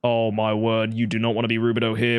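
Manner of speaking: ordinary talk, nothing marked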